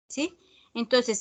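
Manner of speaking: usual speaking voice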